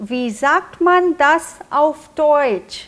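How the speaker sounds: very slow, each syllable laid out for learners; slow teaching model. Shown as a demonstration. Teacher modelling a weekend goodbye for learners, slowly. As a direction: spell the German out slowly so students can copy. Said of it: "आप पूछ सकते हैं".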